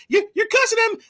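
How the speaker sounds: very high, prim gasp of outrage; mock-offended high squeal; shocked lady. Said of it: "She was like".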